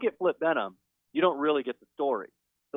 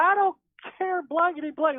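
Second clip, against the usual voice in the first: high, loud mock outburst; Miss Norma; 3 dB louder than usual. Pastor retells Norma refusing to behave, high defiant imitation. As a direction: imitate a defiant rant, pitched way up.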